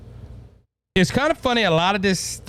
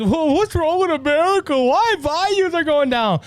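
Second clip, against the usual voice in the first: mock bewilderment; 10 semitones higher than usual. Host mimicking people puzzled by America's decline, mock bewilderment. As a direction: wail it high, as a put-on clueless complainer.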